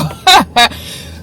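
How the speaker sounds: bright burst of laughter. Real, not acted. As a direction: laugh out loud. Laugh about beating ladies.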